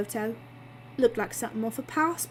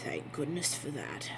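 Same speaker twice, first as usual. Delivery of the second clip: muttered low and much softer; under his breath; 8 semitones lower, 9 dB quieter than usual. A narrator's reading. Alan relieved the Ian lead is parked, under his breath. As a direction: murmur it so nobody hears. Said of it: "under his breath".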